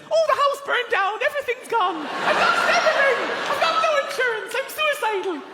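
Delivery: put-on very high, cheery Irish accent; absurdly jovial Irish squeal. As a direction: deliver bad news gleefully in a high Irish accent. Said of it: "it sounds quite fabulous".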